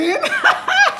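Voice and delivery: loud high burst of laughter; high squealing laugh. Real, not acted. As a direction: crack up loudly. Laughing loudly.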